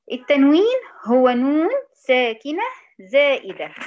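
slow, loud dictation pace. Shown as a demonstration. Teacher dictating to students, slow and loud. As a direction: spell the definition out slowly for the class. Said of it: "تاني".

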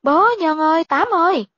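high, urgent call for help; crying out. A narrator's reading. Fleeing woman calls her son and servant, crying out. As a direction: call out to the household, pitched well up. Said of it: "vừa la lên".

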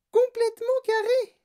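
put-on very high, loud child character voice; Veruca Salt. A narrator's reading. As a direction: protest in a piercingly high voice. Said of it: "dit Veruca Salt". (voix suraiguë de fillette qui proteste)

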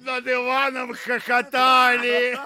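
high, gleeful delivery; mocking glee. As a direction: sing it out high in mockery. High mocking narration of brothers' laughter. (высоко и насмешливо)

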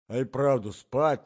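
plain reading voice

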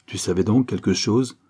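ordinary delivery

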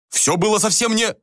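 high, indignant self-defence; urgent protest. Acted. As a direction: protest until cut off. Protest cut off by an attack.

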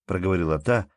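ordinary delivery

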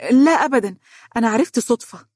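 flustered, high-pitched backpedalling; hasty denial. A narrator's reading. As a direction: jump in to deny it, pitched high. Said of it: "قالت بسرعة".

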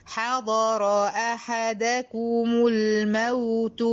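slow, every vowel of حضر أحدكم الموت sounded; careful vowelling. Shown as a demonstration. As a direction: recite each vowel clearly. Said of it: "ركزي في التشكيل". (تلاوة بطيئة بتشكيل واضح)

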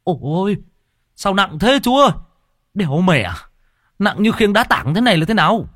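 high, strained complaint under a heavy load; straining through gritted teeth. A narrator's reading. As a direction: groan and gripe as you heave. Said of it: "nghiến răng kèn kẹt".

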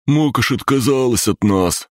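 high-pitched creature voice; high little creature owning up; confessing green creature. A narrator's reading. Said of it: "признался зелёный".

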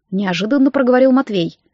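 plain reading voice

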